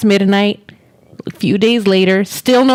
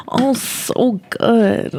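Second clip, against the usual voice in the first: slow breathy whisper; whispered moan; much slower than usual. Retold or imitated. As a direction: breathe it out slowly. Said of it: "someone's gonna be like".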